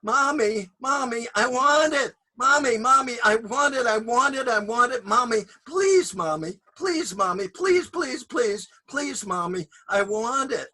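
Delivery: put-on high, loud pleading kid; begging child.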